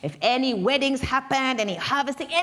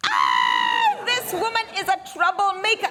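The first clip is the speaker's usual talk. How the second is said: loud, higher accusing cry; outraged shout; 7 semitones higher, 3 dB louder than usual. Acted. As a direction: denounce her before the crowd. Elder condemns Washu publicly.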